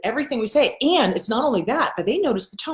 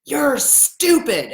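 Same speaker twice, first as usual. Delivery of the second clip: slow, drawn-out scolding father; mock stern dad, dragged out; imitating dad's put-down; 4 semitones higher, much slower than usual. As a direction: hammer out a slow insult as dad. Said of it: "I heard clear as day my dad".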